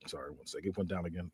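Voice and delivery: unvoiced off-mic aside; whispered aside. Real, not acted. Speaker pausing the story, soft unvoiced aside. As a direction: drop to a whisper to fix something. Aside about device to audience.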